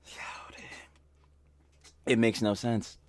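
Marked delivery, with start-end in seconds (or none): 0.1-0.6 breathy stunned whisper. Real, not acted. Stunned by the clip, whispering. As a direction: breathe it out in shock.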